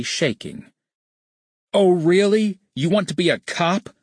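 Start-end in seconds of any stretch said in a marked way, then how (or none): 1.7-3.9 high-pitched, mocking challenge dripping with sarcasm; sarcastic yell. A narrator's reading. Furious boss confronting his employee, yelling sarcastically. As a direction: raise the pitch sharply and sneer it as a question. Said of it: "Sonny yelled at John, his voice dripping with sarcasm".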